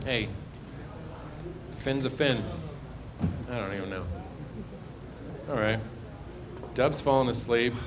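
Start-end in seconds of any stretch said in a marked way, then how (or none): none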